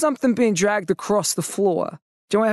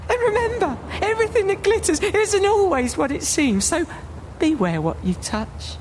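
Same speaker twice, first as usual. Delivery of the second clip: high sinister warning voice; creepy ghost host taunting; spooky host; 8 semitones higher than usual. Acted. Spooky host warns visitor.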